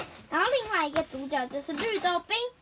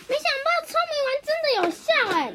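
惊讶; 8 semitones higher, 4 dB louder, much faster than usual. Acted. 惊讶药丸有效.

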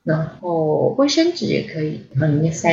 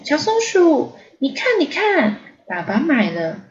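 excited little bear; 8 semitones higher than usual. Acted. Little bear shows off umbrella.

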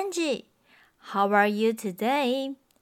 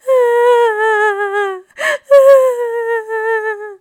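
mock cry; 11 semitones higher, 8 dB louder than usual. Baby Bear cries over chair.

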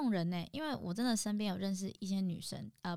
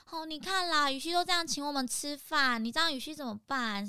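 higher, louder reproachful whine; guilt-trip him in a raised whiny voice; 7 semitones higher, 4 dB louder than usual. Retold or imitated. Friend nagging the godfather in front of 雨熙, higher and louder. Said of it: "那个女生就在那边就说".

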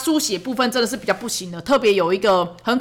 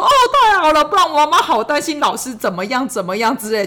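much higher, louder overacted relief; hammed-up relief; 5 semitones higher, 3 dB louder than usual. Mother overacting relief to her son that he will write. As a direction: ham it up as if hugely relieved. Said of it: "就是要演很大那一种".

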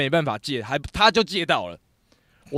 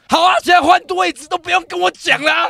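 much higher, loud sarcastic yell; angry director yelling at the crew; furious shout; 10 dB louder than usual. Retold or imitated. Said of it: "他就超生气说".

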